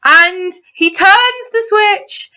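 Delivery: emotional awe. Real, not acted. Emotional retelling of hero's walk.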